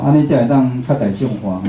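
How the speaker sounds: ordinary delivery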